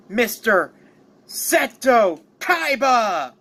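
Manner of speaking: slow, stretched-out name reveal; drawn-out cliffhanger introduction; dramatic announcer. Acted. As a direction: announce the contender with rising grandeur.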